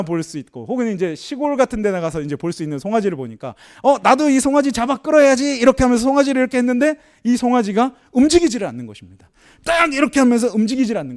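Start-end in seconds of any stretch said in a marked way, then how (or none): 3.8-7.0 put-on bright, keen little boy, pitched higher and louder; a small boy itching to drag the calf; eager child. Son at the calf, eagerly higher and louder.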